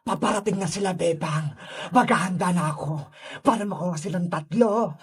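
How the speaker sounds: very low, hushed villain scheming; Sadako villain voice. Acted. Sadako plotting to catch all three, low and sinister.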